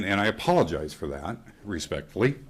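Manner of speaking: ordinary delivery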